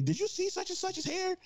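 high, put-on gossipy voice. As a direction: mimic a gawking woman in falsetto.

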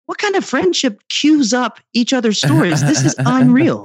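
high-pitched mock-astonished outburst; amazed disbelief. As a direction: marvel in disbelief, drawing out the last words. Retells his reaction to their stories.

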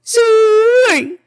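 very high, loud falling sweet; silly squeaky voice. Acted. Echoing silly sign-off.

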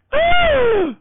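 dramatic theatrical gasp; loud shocked gasp. Retold or imitated. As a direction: gasp like the theater just gasped for you. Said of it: "really loud at that split second".

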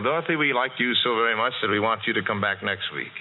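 normal talking voice

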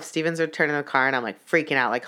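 ordinary talk, nothing marked

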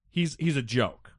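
ordinary talk

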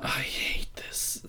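slow, breath-only whisper; despairing whisper. Real, not acted. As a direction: whisper it wearily, drawn out. Despairing aside about the show.

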